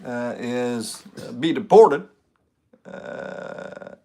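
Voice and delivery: low, halting Obama voice; slow hesitant presidential cadence; Obama impression. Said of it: "Can you do an Obama being deported to Kenya?".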